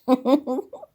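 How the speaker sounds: a high, loud burst of laughter; delighted laugh. Real, not acted. Delighted by the choirs.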